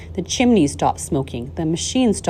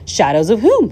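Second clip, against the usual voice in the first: loud, higher eager question; quickly; 5 semitones higher, 7 dB louder than usual. A narrator's reading. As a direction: jump in with the question. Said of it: "said Charlie quickly".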